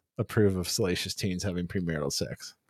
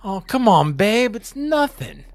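high, drawn-out dismissive coaxing; wheedle, stretching the last words; coaxing boyfriend; 11 semitones higher, 3 dB louder, slower than usual. Acted. Boyfriend brushing off his girlfriend's fear, coaxing.

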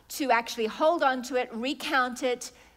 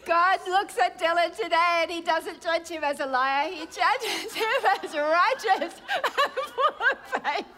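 higher, louder, laughter in the words; laughing while preaching; 6 semitones higher, 4 dB louder than usual. Real, not acted. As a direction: preach it still amused.

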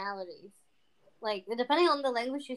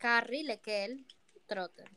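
"Carri Lequel Trotter" with Spanish R's; Spanish accent; 4 dB quieter than usual. Shown as a demonstration. Pulling out his full name, Spanish accent. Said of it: "the English don't sound so intimidating".

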